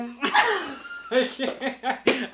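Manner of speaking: laughing refusal. Real, not acted. Partner laughing, refusing moan.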